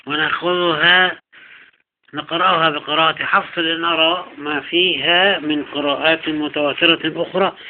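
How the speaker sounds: ordinary talk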